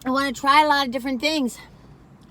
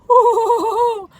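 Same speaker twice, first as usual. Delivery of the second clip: loud bursting laugh; big laugh; 12 semitones higher, 11 dB louder than usual. Real, not acted. Laughing loudly over a treat.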